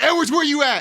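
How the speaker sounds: calling out. Retold or imitated.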